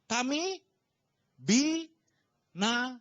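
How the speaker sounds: high, very slow, broken syllable by syllable; drawn-out cry. Retold or imitated. Preacher quoting disciples in the storm, drawn-out cry. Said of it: "kenapa mereka teriak-teriak".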